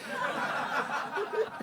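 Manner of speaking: audience laughing; laughter from the room; crowd laughter. Real, not acted.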